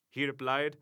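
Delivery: plain reading voice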